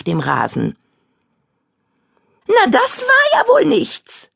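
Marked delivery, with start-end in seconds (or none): 2.5-4.3 high, slow gloating witch. Acted. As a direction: gloat over her failure. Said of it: "sagt Kadabra hämisch".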